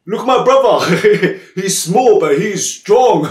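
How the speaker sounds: high, loud, drawn-out acted boast with laughter; proud showing off. Elephant showing off his small strong brother, proud. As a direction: crow it slowly through laughs. Elephant shows off mouse friend.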